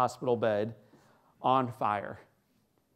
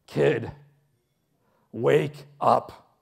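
put-on loud, punched call to wake a kid; commanding announcer voice; 6 dB louder, much slower than usual. Announcer at a dying boy's bedside, loud command. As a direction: imitate a booming announcer rousing someone. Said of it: "I hear this voice that says to me".

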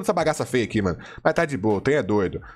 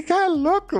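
excited. Real, not acted. Excited opening of case battle.

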